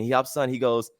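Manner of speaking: usual speaking voice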